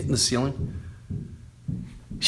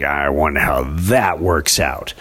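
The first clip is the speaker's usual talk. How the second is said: low, slow dry sarcasm; deadpan doubt, drawn out low; sarcastic aside; 8 semitones lower, slower than usual. Retold or imitated. Husband mocking his wife's phone rule, low sarcastic deadpan. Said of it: "I'm like".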